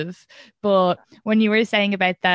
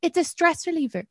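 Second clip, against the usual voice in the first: higher-pitched indignant insistence; retold defensive retort; 7 semitones higher than usual. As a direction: protest your case to the boss, pitched up. Retold tearful retort to boss.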